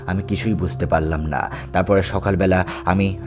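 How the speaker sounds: ordinary delivery, nothing marked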